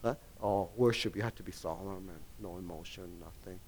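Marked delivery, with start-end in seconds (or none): 1.2-3.6 very soft and low, fading to a whisper; lowered hush sliding into a whisper; mock solemn hush. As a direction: parody dead-serious worship, draining all life. Mocking solemn no-emotion worship.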